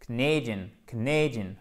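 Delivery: "Canadian" as "k'NAY-dyun", falling; full-word drill. Shown as a demonstration. Teacher joining syllables for students, "Canadian" stressed on "nay". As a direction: clip "Ca" short onto stressed "nay" in "Canadian". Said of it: "C'est la première syllabe".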